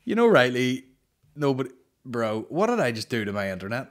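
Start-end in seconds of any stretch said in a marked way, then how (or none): none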